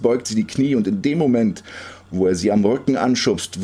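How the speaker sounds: plain reading voice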